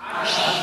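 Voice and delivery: "Ash" with a rough, strongly hissed sh; hissing shin. Shown as a demonstration. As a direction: push air hard through the teeth on "Ash". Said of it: "Ada angin lesus di mulut jenengan".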